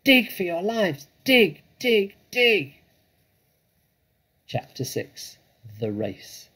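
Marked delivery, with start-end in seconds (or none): 0.0-2.7 high, loud, urgent commands; shouted. A narrator's reading. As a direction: shout urgent orders, growing louder and higher. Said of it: "shouted Mister Fox".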